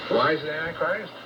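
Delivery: ordinary delivery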